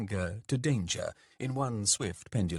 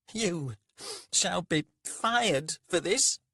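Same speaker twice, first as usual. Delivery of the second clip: high, slow, haughty threat; tied-up merchant threatening his servant, haughty; indignant snort; 10 semitones higher, 4 dB louder, slower than usual. A narrator's reading. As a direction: spit it out like a humiliated lord. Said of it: "snorted Rika".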